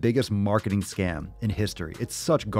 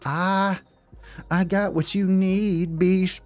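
drawn-out catchphrase; 9 semitones higher, slower than usual. Shown as a demonstration. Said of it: "repeats his catchphrase".

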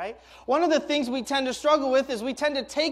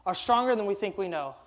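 ordinary talk, nothing marked

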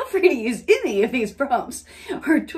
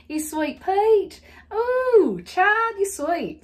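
higher, exuberant Southern gushing; Southern accent; 9 semitones higher, much slower than usual. Pretend. Gushing at a sweet child, Southern accent. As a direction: gush with drawn-out Southern vowels. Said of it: "It just doesn't sound right".

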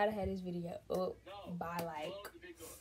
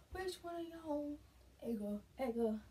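ordinary talk, nothing marked

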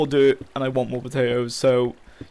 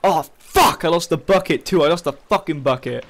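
real exasperated cursing; angry dismay; 3 semitones higher, 5 dB louder than usual. Real, not acted. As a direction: burst out on realising the loss. Realises another item is lost.

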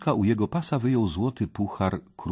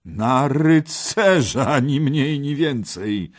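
loud, high, drawn-out scorn; mocking jeer; 8 semitones higher, 7 dB louder, slower than usual. Kej jeers at the would-be knight, loudly mocking. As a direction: repeat the request with mocking relish. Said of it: "szydził pan Kej".

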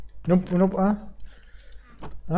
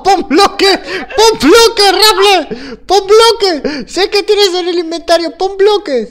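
laughing shouts; 9 semitones higher, slower than usual. Real, not acted. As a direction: yell it while cracking up. Frantic calls during a fight. (gritos entre risas)

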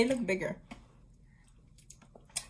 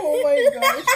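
high, through laughter; laughing; 8 semitones higher, 4 dB louder than usual. Real, not acted. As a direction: gasp it out laughing. Burst of laughter.

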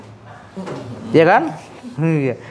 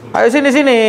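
called out; 9 semitones higher than usual. Real, not acted. Calling the patient over.

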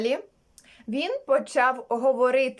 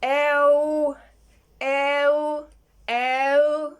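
long, held "еу", slightly raised; sound demonstration; 3 semitones higher, 4 dB louder, much slower than usual. Acted. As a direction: stretch each "еу" out evenly. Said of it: "Тім сказав". (протяжне «еу»)

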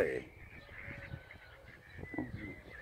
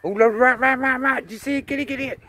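high, loud, thrilled yelling at the dog; excited shouting; 16 dB louder than usual. Real, not acted. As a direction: shriek it out as you spot the rat. Spots a rat, urges dog.

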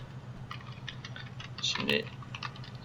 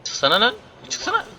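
high-pitched exasperated order; high demand; 12 semitones higher, 5 dB louder than usual. Real, not acted. Gamer ordering the driver out, high exasperated squeal.

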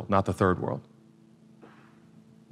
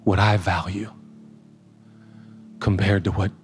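tender voice of God; 7 semitones lower than usual. Pretend. Voicing God's gentle reply.

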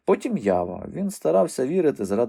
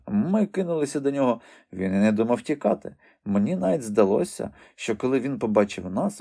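ordinary delivery

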